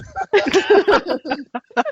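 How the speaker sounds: real high burst of laughter. Real, not acted. Laughs at coach's accent.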